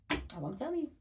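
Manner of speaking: normal talking voice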